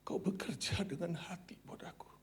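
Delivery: soft whispered tender words; hushed voice of God. Retold or imitated. God softly calls his faithful servant home, hushed whisper. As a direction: breathe it like a gentle calling. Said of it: "Tuhan berkata".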